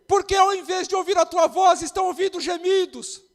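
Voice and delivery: first call. Real, not acted. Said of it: "repeated, stronger".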